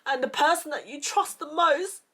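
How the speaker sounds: upset, high-pitched, voice rising with panic; really distressed while reliving a frightening memory; real fear. Real, not acted. Telling viewers of a friend's betrayal, scared and upset. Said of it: "this really fucking scares me".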